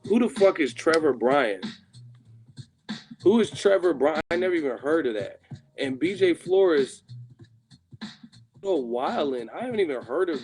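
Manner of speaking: loud, high outraged disbelief. Real, not acted. Streamer yelling at unknown boxers in the rankings, loud disbelief. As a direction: yell it in disbelief at the list.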